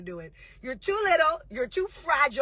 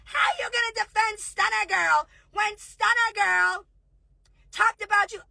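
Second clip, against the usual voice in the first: mocking quote; 8 semitones higher than usual. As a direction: mimic her shouting the accusation. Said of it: "now you're like".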